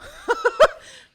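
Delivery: loud, high-pitched laugh; burst of laughter. Real, not acted. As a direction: crack up loudly mid-sentence. Cracks up over the series.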